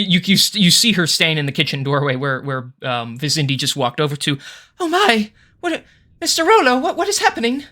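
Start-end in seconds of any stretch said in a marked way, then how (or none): 4.8-7.6 high, flustered servant voice; much higher shocked maid; startled maid. Acted. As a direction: gasp it out like a panicked maid.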